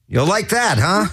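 ordinary talk, nothing marked

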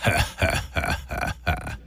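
creepy breathless laugh. Shown as a demonstration. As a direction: let out a creepy hissing laugh. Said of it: "My real laugh is like this".

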